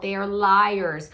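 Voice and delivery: slow, weighty stress on liars; emphatic. Real, not acted. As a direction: lean hard on liars. Emphatic warning.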